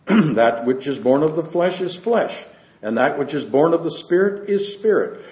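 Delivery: scripture reading. Acted. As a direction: read the Gospel out to the church with weight. Reads scripture aloud to congregation.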